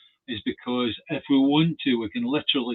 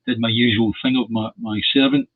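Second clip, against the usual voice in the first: ordinary delivery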